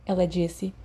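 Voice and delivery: usual speaking voice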